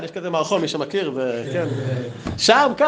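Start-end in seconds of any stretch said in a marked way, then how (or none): 2.4-2.9 high, loud retort; mock insistent answer; acted insistence. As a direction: fire back both words, raised and high. Retold rabbi insisting.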